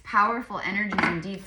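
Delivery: normal talking voice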